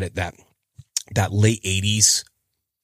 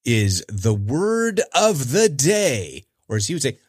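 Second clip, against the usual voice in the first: usual speaking voice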